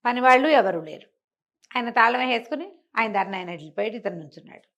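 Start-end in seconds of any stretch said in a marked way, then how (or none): none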